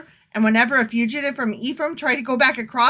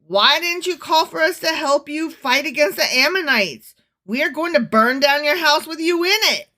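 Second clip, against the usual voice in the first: loud, higher, hammed-up outrage; indignant threat; 4 semitones higher, 5 dB louder than usual. Ephraim's men threatening Jephthah, loud hammed-up indignation. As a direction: threaten them like an angry mob.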